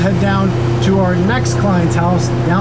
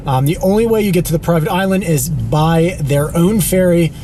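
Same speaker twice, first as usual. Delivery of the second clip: ordinary talk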